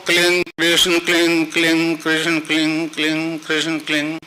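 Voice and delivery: even, continuous mantra repetition, gradually fading; mantra japa. Shown as a demonstration. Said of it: "अब आप जपो". (एक सुर में लगातार मंत्र जाप)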